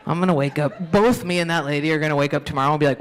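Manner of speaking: ordinary talk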